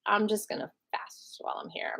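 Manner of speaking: whispering the refusal under the breath; whispered. Retold or imitated. To the guard, quietly refusing the food in a whisper. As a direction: hush it like you don't want to be heard.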